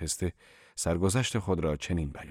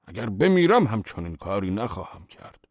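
ordinary delivery

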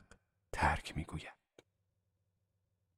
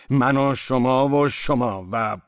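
slow, loud count, left hanging; hesitant listing; 5 semitones higher, 5 dB louder, much slower than usual. A narrator's reading. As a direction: count people off emphatically, then break off unsure. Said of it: "بعد از کمی مکث و تردید". (شمردن کشیده و بلند، با تردید در پایان)

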